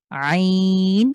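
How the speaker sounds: very slow আইন squeezed from mid-throat; throaty ain. Shown as a demonstration. Teacher slowly demonstrates আইন for students. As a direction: drag out আইন pressing the throat. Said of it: "কণ্ঠের সাহায্যে মাঝখানটা চাপ দিয়ে".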